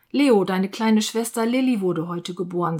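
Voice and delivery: ordinary delivery, nothing marked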